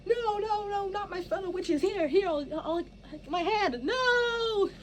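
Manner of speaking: high, crying pleas to fallen friends; desperate pleading. Shown as a demonstration. Cadence watching her fellow witches vanish, high tearful cries. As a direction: reach out sobbing, losing them. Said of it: "That's you, like".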